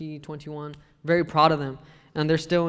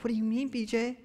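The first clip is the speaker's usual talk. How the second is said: put-on girls asking; 7 semitones higher than usual. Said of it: "And the girls were like".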